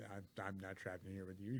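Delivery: low, soft quoted warning; retold threat. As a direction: drop the voice for a quiet threat. Quoting cornered hero's threat.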